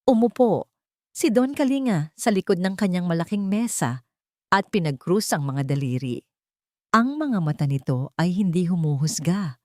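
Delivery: usual speaking voice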